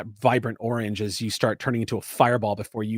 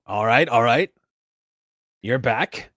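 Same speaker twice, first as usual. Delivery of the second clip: lightly voiced gravelly pilot; a hint of gravel in the voice; Devar. Gravelly wing pilot checking in over the comms, lightly gruff. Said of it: "this is the gravelly voice of Devar".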